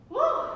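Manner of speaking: high, loud cheering whoop; excited whoop. Real, not acted. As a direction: let out a big excited woo. Instructor cheers class mid-flow.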